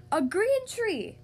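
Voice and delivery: high, loud, drawn-out exclamation; exclaiming. A narrator's reading. King reacts to the painted tree, loud exclamation. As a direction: exclaim it slowly in high astonishment. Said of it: "exclaimed the king".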